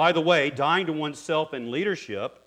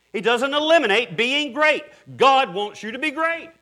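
high, loud and fervent; rising preacher's shout; 11 semitones higher, 8 dB louder than usual. Real, not acted. Pastor driving home the point to the congregation, fervent shout. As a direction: proclaim it, lifting the voice to the rafters.

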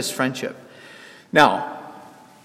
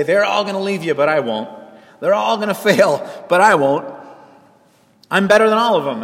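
boastful Peter; 4 semitones higher, 5 dB louder than usual. Retold or imitated.

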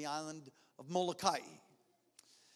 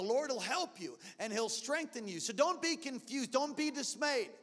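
higher, insistent sermon push; preaching lift; 7 semitones higher, 5 dB louder than usual. Acted. Pastor urging the congregation not to be dismayed, rising. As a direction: lift the voice to urge them on.

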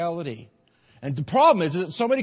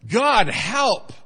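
loud, higher plea to God; loud cry for help; 5 semitones higher, 5 dB louder than usual. Shown as a demonstration. Echoing the loud congregant, loud cry. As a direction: call out for help with force. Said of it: "you said that real loud".

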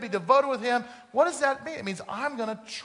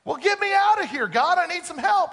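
desperate calling out; 10 semitones higher, 6 dB louder than usual. Retold or imitated. Jack begs God from cliff.

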